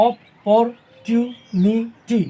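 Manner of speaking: "অপরচুনিটি" very slowly, five separate syllables; split into five syllables. Shown as a demonstration. Teacher dividing a word for pupils, "অপরচুনিটি" in five parts. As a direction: pause after each syllable of "অপরচুনিটি". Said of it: "ভাগ করে দিচ্ছি".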